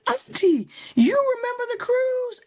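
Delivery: very high, loud, amazed niece, imitated; mock hysterical astonishment. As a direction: squeal it like an overwhelmed niece in a retelling. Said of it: "hysterical and crying".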